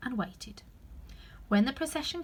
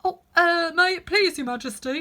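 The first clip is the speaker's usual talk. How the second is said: high, hesitant, humble voice; 9 semitones higher, 9 dB louder than usual. A narrator's reading. Two answering the Queen, very humbly. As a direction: stammer meekly in a high, raised voice. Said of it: "said Two in a very humble tone".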